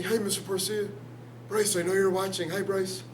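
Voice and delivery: imitating Bryce. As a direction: mimic a former student, small and high. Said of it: "he talked like this".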